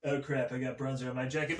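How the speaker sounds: low, quick aside in a put-on voice; Donald Trump. Comic as former president noticing a stain, low quick grumble. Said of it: "this guy".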